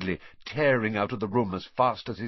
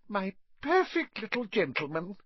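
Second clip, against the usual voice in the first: much higher, doting sniff of pride; sniffy aunt; 12 semitones higher than usual. A narrator's reading. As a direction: coo it like a proud mother. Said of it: "sniffed Aunt Petunia".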